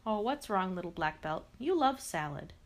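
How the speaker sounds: ordinary delivery, nothing marked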